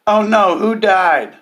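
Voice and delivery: high, loud mock-concerned oh no; mock worried commenter. Mocking the first commenter on the grief post, high and loud. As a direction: ham up the alarmed commenter. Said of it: "first comment says".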